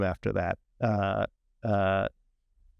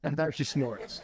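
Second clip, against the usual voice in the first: ordinary talk, nothing marked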